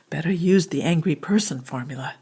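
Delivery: usual speaking voice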